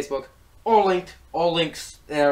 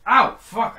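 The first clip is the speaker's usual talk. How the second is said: sudden higher, louder ow; pained yelp; 7 semitones higher, 7 dB louder than usual. Real, not acted. Vlogger hitting her elbow, yelping higher and louder.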